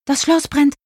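high-pitched startled thought; sudden high-voiced fright, not shouted; startled alarm. A narrator's reading. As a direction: blurt it as if jolted awake. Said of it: "dachte sie noch im Halbschlaf".

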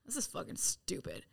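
whispered grumble; muttered whisper. Retold or imitated. Grumbling about being sent inside, whispered. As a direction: mutter it under your breath. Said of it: "And I'm like".

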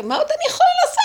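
high, loud, fast wail; exasperated outcry. Retold or imitated. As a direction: burst out at the end of your rope. Acting her exasperated cry to God.

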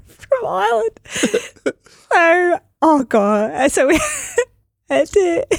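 high voice, still cracking up; real laughing. Real, not acted. Traveller recalling her absurd tour start, laughing. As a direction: struggle to get the story out through giggles.

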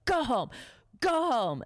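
high retold yell in short bursts. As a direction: imitate a boss ordering someone out. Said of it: "he's yelling at you too".

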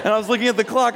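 normal talking voice

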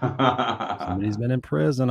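drawn out, knowing tone on prison; slow teasing insinuation. Acted. Host teases the guest about prison, slow knowing tone. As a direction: lean on prison slowly, teasing. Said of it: "I'm just saying".

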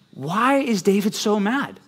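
high, slow puzzled question; a hint of a confused listener. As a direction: ask it like a baffled student.

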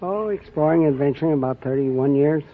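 normal talking voice

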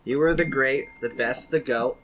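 slow, deliberate mock-solemn recital; poem reading. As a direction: read it like a eulogy in verse, slowly. Reads own pet epitaph poem.

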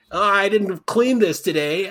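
high, slow goofy character voice; high, slow mocking voice; mock wrestler voice. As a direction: play a dopey wrestler, slowly. Imitating Mankind.

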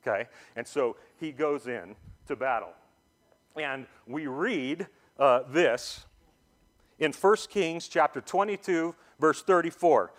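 ordinary delivery

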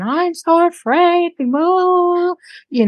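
high mock fearful whine, slow; hammed-up frightened old woman, slow and high; mock frightened mother. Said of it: "acting like a crazy woman".

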